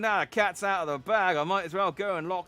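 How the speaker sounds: put-on loud start in a shaky American accent; badly held American accent, drifting Australian; wandering American accent. [name] offers to go to the army lab, wandering American accent. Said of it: "I can't quite do this accent".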